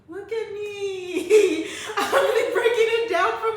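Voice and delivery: high, slow, laughing brag; proud laughing. Retold or imitated. Proud of herself after coping with mom, laughing high and slow. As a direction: laugh it out, impressed with yourself.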